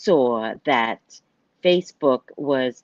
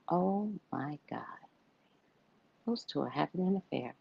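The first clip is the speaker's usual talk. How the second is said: much softer, secretive gossip; hushed aside; 14 dB quieter than usual. Retold or imitated. Spotting a party affair, softer hushed gossip. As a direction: murmur it so nobody at the table hears.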